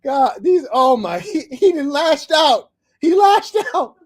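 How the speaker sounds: high, loud, drawn-out crowing; gleeful exclamation. Real, not acted. Host delighting at caller's outburst, gleeful exclaiming. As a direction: crow it out, cracking up.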